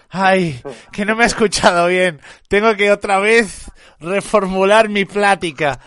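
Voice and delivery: loud, laboured mock exasperation; mock exasperated Dallas. Streamer parodying Dallas having to repeat himself, mock exasperated. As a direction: imitate a self-serious debater, drawing out the complaint. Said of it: "Se pone así".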